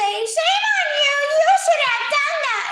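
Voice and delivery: shrill-free high scolding shout; high mocking scold. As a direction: scold them loudly from way up high. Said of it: "they go on and say". (regaño burlón en voz muy aguda)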